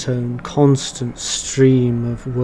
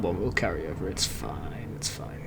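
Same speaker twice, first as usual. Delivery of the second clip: softer and softer, it's fine; fading reassurance; 7 semitones lower, 13 dB quieter than usual. Real, not acted. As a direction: trail off into a near whisper.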